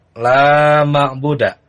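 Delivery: ordinary talk, nothing marked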